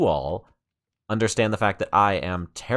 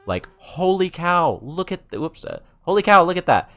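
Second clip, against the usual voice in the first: high, excited exclaiming; amazed; 9 semitones higher, 5 dB louder than usual. Real, not acted. Showing viewers the historic district, high and amazed. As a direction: gasp out your amazement at the build. Awed by a friend's Nether build.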